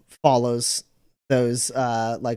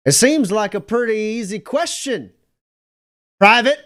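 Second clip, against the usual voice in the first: stern officer; 7 semitones higher, 5 dB louder than usual. Acted. Officer's voice rings out from line.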